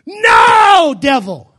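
full-throated shout, "No" flung up high; loud shout. Shown as a demonstration. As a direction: roar it at the devil with everything. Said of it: "you gotta shout ... with a loud".

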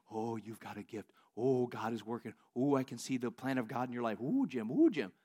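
hushed, low gushing; admiring churchgoers. Retold or imitated. People afterwards praise the preacher's gift, hushed mock awe. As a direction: coo in soft, low awe. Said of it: "people afterwards, they say".

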